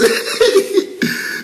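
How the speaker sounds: high breathless burst of laughter; big laugh. Real, not acted. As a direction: let a real laugh burst out. Pastor laughs at his comment.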